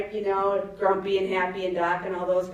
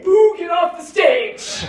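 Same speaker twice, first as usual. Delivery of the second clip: mock heckler shout; 10 semitones higher, 10 dB louder, slower than usual. Comic quoting heckle title.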